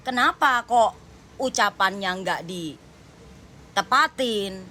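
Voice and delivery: usual speaking voice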